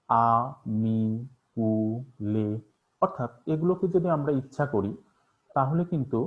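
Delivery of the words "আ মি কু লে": আ, মি, কু, লে each syllable stretched long; drawn-out syllables. Shown as a demonstration. Teacher stretching open syllables to show they are free. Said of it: "যেমন".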